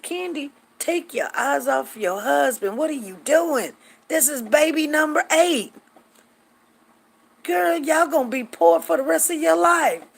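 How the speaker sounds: high, exasperated telling-off; playful scolding. Retold or imitated. Acts out scolding friend over baby.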